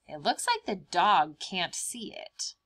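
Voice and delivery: usual speaking voice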